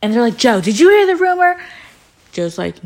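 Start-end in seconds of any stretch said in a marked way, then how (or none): 0.4-1.6 high, loud, rapid questioning; mock interrogation. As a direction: imitate friends urgently grilling him. Others grilling Joe, acted.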